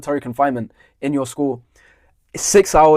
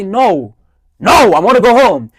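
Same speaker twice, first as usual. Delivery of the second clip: loud, high, drawn-out refusal; retold defiant shout; 6 semitones higher, 7 dB louder, slower than usual. Pupil refusing to line up for his teacher, defiant shout. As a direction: dig in and shout it back like a kid refusing.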